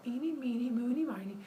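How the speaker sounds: high half-whispered eeny meeny; counting rhyme. Acted. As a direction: pick playfully, whisper the end. Baby picks a cow.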